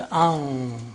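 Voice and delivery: slow "anf", n hidden into the f; ikhfa. Shown as a demonstration. Teacher demonstrating ikhfa to students, drawn-out hidden n in "anf". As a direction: stretch the nasal in "anf" toward f. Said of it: "This is ikhfa".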